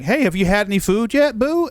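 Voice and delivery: high, sing-song sweet "boo"; sweet checking in. Retold or imitated. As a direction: coo it at your wife with affection.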